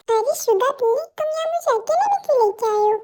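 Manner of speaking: usual speaking voice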